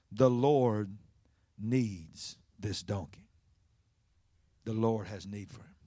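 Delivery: low, soft and slow; calm reply. Acted. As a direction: answer the yelling with hushed, measured calm. Disciples' calm reply to yelling owner.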